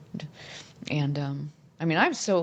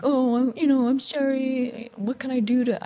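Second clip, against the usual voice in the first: much higher, whiny mock apology; pleading husband; 8 semitones higher, 3 dB louder than usual. Quoting her husband begging forgiveness, higher whiny plea. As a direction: ham up a groveling, wheedling sorry. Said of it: "'cause then he's like".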